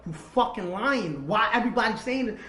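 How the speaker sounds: loud, forceful accusation; raging. Retold or imitated. Man demanding answers from girlfriend, raging. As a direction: relive the fury, leaning hard on the words. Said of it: "I'm, I'm, I'm raging".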